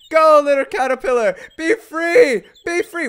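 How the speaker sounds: loud, high cheering send-off; high excited call to tiny creatures. Pretend. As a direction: cheer them on to freedom. Cheering caterpillars loose.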